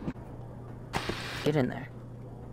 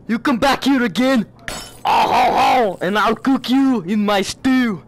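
higher-pitched, badly faked French accent; horrible French accent; 5 semitones higher than usual. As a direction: threaten in a hammed-up French accent. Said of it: "that's a horrible French accent".

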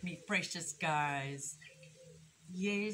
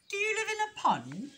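high, louder, curious bunny voice; innocent young rabbit; 13 semitones higher, 7 dB louder than usual. A narrator's reading. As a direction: ask it brightly and naively.

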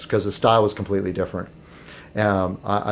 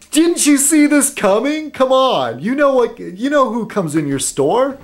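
incredulous; 13 semitones higher than usual. Retold or imitated. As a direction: scold them for not seeing it coming. Exasperated at the shop owner.

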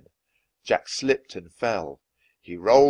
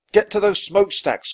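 high, loud urging; urgent cry; 11 semitones higher, 7 dB louder than usual. A narrator's reading. Jack to Annie on the sinking ship, crying out. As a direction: shout the order over the chaos. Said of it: "cried Jack".

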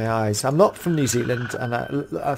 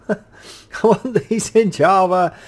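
ordinary talk

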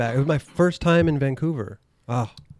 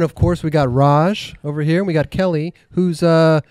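ordinary talk

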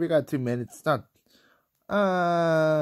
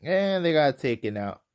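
normal talking voice

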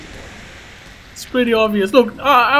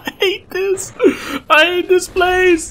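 high-pitched, distressed complaint; shaken wail; 9 semitones higher, 3 dB louder, slower than usual. Real, not acted. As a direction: wail it straight after a fright. Shaken right after scare.

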